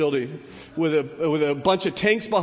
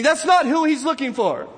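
ordinary delivery

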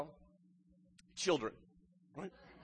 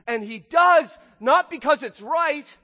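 loud and higher, driving the point home; preaching shout; 8 semitones higher, 11 dB louder than usual. Real, not acted. Preacher stressing the judge's motive, loud and higher. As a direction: belt it out from the pulpit.

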